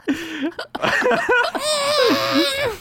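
squealing laugh; high cackling laugh. Real, not acted. Big laugh.

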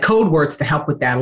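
ordinary talk

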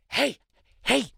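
normal talking voice

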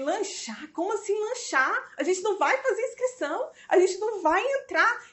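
high, outraged retelling of her own protest; protest, voice up high and slowing; indignant disbelief. Retold or imitated. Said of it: "Aí eu falei". (indignação alta e incrédula)

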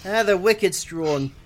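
slow, hammed-up Boston accent. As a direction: lay the Boston on thick, slowly. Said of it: "everybody on Annie's Lobby has a Boston accent".

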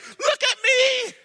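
put-on high, slow, half-laughing boy; high boy's plea with a laugh; pleading boy. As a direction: show off his sorry state. Boy points to his dots.

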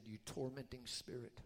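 hushed, steady binding prayer; whispered rebuke. Retold or imitated. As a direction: whisper it firmly into her ear. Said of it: "and whispered in her ear".

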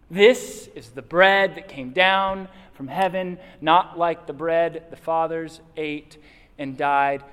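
slow, loud, solemn reading; scripture reading. Acted. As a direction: read it slowly with weight.

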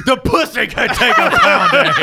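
high, loud and slow, laughing; mock high shout. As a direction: squeal it out mockingly through laughter. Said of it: "and we're like".

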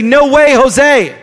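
loud, firm brush-off; defiant refusal. Pretend. Believer rejects the voice of fear: loud, defiant. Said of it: "recognize them and say".

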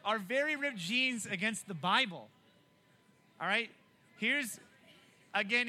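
usual speaking voice

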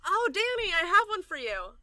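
high, loud, gleeful burst; excited calling out. Acted. Lavender offering Danny a button, excited. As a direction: call out brightly, voice leaping up high.

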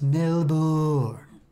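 Melbourne as mel-BOOORN with lots of O's; exaggerated wrong pronunciation. Shown as a demonstration. Mocking the spelled-out way, exaggerated wrong pronunciation. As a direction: stretch the second syllable of Melbourne into a long oo. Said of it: "with, like, a lot of O's".

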